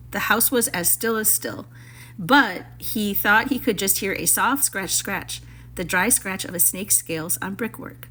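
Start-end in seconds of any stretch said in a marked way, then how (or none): none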